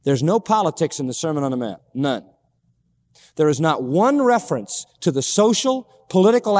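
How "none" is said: ordinary talk, nothing marked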